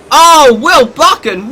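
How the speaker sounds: slow, loud gasp of surprise; surprised exclamation. Real, not acted. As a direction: react to a near crash. Driver nearly crashes.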